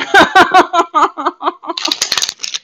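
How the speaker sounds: loud high rising laughter. Real, not acted. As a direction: crack up over fried okra. Laughing at fried okra.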